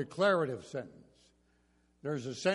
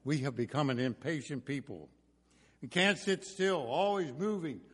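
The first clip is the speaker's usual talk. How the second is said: ordinary talk, nothing marked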